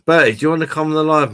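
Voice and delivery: quick, high, eager call to a viewer; excited call. Real, not acted. Streamer eagerly inviting Bertie onto the live: quick excited call. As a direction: hurry the invite out brightly.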